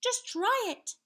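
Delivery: put-on high, loud child hero; little knight. A narrator's reading. Young knight stands up to the giant, defiant. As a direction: dare him in a high boyish voice. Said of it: "said Sir Scallywag".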